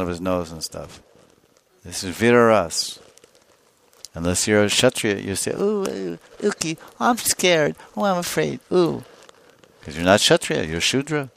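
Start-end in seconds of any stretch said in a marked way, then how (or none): 5.5-9.0 very high squeamish whimper; mock a coward who can't stand blood; put-on high voice of timid fright; mock scared. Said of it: "Shudras are afraid of blood".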